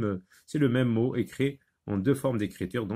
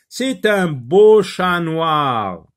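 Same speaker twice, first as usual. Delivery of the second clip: slow, high, very loud model reading; reading a sentence aloud; 6 semitones higher, 11 dB louder, much slower than usual. Shown as a demonstration. Teacher reads the matching phrase to pupils, slow and loud. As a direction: read it out grandly, stretching each word. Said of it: "on va lire la première phrase".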